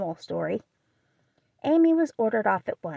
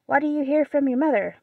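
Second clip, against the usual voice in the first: put-on louder, higher gruff old-lady question; 6 semitones higher, 5 dB louder, faster than usual. Aunt March asking Jo about mother, gruff. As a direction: bark it out like a cross old aunt. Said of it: "asked the old lady gruffly".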